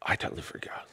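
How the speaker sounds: breathy whisper under her breath; sheepish whispered self-reproach; whispered aside. Real, not acted. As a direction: whisper it to yourself, embarrassed. Muttered aside to herself.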